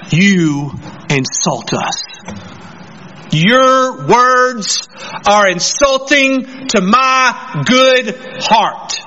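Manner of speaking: very slow, pompous mock affront; insulted lawyer. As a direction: draw out every word as if deeply offended. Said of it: "they were insulted".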